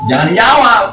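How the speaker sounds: loud, high, insistent; emphatic warning. Real, not acted. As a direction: shout the warning to the crowd. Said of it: "menekankan larangan". (seruan tegas, keras dan tinggi)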